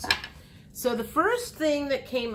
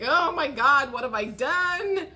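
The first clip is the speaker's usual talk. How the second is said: a hint of panic, higher; fretting over a mistake; mock dismay; 6 semitones higher, 4 dB louder than usual. Gemini losing sleep over it, mild mock dismay.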